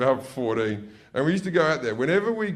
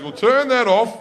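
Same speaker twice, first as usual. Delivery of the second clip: nervous dad; 8 semitones higher, 5 dB louder than usual. Retold or imitated. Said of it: "dad when they're nervous".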